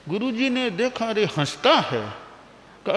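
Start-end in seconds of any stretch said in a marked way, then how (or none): none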